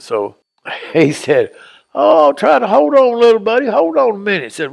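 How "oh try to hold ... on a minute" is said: put-on reassuring old neighbour. Neighbour soothing a crying little boy on the hill, raised reassuring voice. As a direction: coax him along gently, higher than usual.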